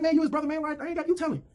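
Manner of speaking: high-pitched mock protest; pleading, high retold refusal. Sam refuses to break news.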